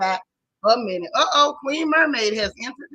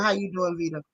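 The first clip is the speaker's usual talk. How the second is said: ordinary delivery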